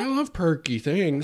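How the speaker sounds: high-pitched actor impression; Owen Wilson. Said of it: "I'll be Owen Wilson".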